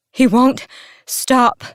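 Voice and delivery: loud, high, out-of-breath urgency; panting. A narrator's reading. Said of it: "Selena panted".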